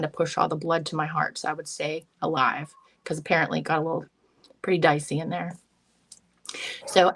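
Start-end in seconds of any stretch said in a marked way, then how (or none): none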